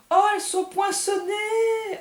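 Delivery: exclamation ravie, bien plus aiguë et plus forte, lente; s'extasier en découvrant les cartes poinçonnées; cri de joie. Real, not acted. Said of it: "Ah, j'adore". (découvrant les cartes, elle s'écrie, ravie et aiguë)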